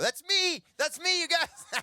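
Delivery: high, loud, hammed-up delight; excited outburst. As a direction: shout it like you spotted yourself onscreen. His excited reaction to the movie.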